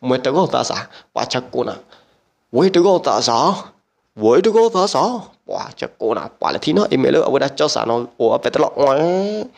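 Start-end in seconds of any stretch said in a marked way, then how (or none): 2.5-5.2 raised, higher-pitched call; imitated call, pitched up. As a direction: call it out twice, pitch climbing. Acted call, said twice, raised voice.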